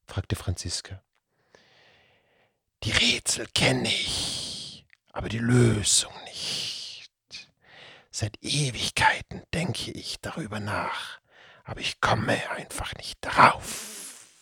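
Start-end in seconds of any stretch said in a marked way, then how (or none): 2.8-14.4 sulky, hushed serpent whisper; grumpy creature whisper. Acted. The Datzelwurm admitting he can't solve them, sulky whisper. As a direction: grumble it under your breath, peeved. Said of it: "entgegnete der Datzelwurm missmutig".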